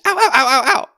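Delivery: high, loud yelps of pain; stabbed man yelping loudly; acted pained scream. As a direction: scream in pain, cry after cry. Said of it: "when he screams".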